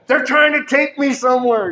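loud, high-pitched retold panic; freaked-out cry. Man waking in hospital warns Natalie, freaked out. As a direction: yell it like you're being dragged away. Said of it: "I freaked out".

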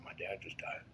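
repeats it in a hushed whisper; half-voiced whisper so only she hears. To the girl beside him at the funeral, whispered.

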